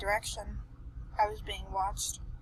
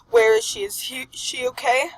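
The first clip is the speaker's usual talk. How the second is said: panicked; 8 semitones higher, 8 dB louder than usual. Acted. Skeleton grabs shoulders in panic.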